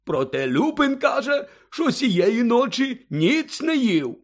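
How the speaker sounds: high, slow, boozy storytelling; merry drunken character voice, high and drawn out; tipsy Hagrid, joyful. A narrator's reading. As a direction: ramble happily like a big tipsy friend. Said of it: "радісно позираючи довкола".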